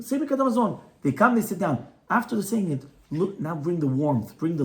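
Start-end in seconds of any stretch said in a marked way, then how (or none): 0.0-0.8 higher, firm order at normal volume; firm without raising the volume; firm but unshouted command; firm, not screaming. Shown as a demonstration. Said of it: "you don't have to say it, uh, screaming".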